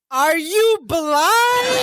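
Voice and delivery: mock stunned, high and very slow; hammed-up cousin asking if he's blind; incredulous Balky. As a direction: drag it out in wide-eyed disbelief. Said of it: "he just goes".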